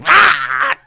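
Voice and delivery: loud, high-pitched "ah"; short theatrical yelp; high villain cry. Acted. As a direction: let out a high cartoon-villain shriek. Villain cry after Skeletor demo.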